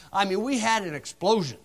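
normal talking voice

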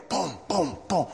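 normal talking voice

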